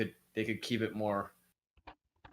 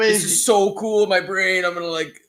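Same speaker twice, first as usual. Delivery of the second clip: bad acting; 4 semitones higher, 10 dB louder than usual. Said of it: "I would come up with that idea and be like".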